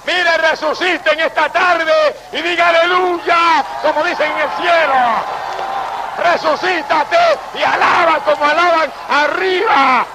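high, slow preaching with stretched praise shouts; a preacher urging the church to praise like heaven, fervent shouting; full-force preaching. Acted. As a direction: call them to praise, stretching every aleluya.

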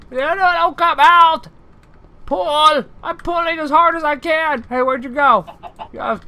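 normal talking voice

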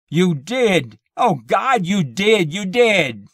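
high, loud wailing accusation; uncontrollable grief. A narrator's reading. Weeping husband accusing wife, uncontrollably grieving. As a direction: wail as if inconsolable. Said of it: "His grief was uncontrollable".

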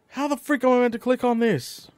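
high, loud frustrated complaint; exasperated. Real, not acted. Gamer unable to click on the machine, exasperated. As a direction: demand an answer in exasperation.

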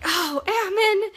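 high, loud, drawn-out scolding; mock exasperation. Friend reacting to husband's prank, loud mock exasperation.

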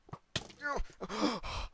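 high, sharp gasp of fright; panicked intake of breath; startled gasp. Real, not acted. Near death in game.

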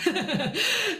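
high burst of giggles; short, high-pitched chuckle; laughing. Real, not acted. Laughs on showing Marvin.

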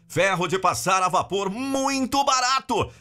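high, punchy hard-sell retail announcer; retail ad read. Shown as a demonstration. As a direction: sell the deals like a TV store ad. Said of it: "Essa locução em específico é nesse estilo".

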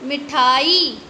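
मिठाई with the long ई drawn out slowly; teacher showing how to stretch मिठाई; stretched reading. Shown as a demonstration. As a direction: draw out the end of मिठाई. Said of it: "हम ऐसे ही बोलते हैं मिठाई".

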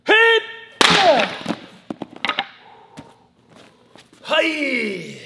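loud high battle yell; barbarian. Real, not acted. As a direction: roar it as the elbow drops. Elbow strike through six boards.

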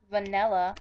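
Vanilla as "vuh-NELL-uh"; nonstandard pronunciation. Shown as a demonstration. Admitting own odd way, Vanilla as "vanella". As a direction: open the stressed vowel of Vanilla to eh. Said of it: "I do say vanilla wrong".

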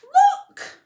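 high, loud excited shout; excited cry. Acted. Peter spotting the thief, high excited cry. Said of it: "and Peter cried".

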